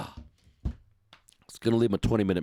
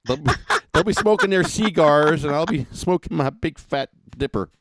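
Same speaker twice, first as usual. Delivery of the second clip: laughing; 7 semitones higher, 6 dB louder than usual. Real, not acted. Laughing about partying at the track.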